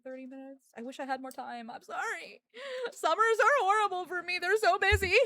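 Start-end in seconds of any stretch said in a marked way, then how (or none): 2.9-5.1 high, louder lament; whiny loud complaint. Real, not acted. Streamer to chat, loudly whining about summer. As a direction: whine it out to chat.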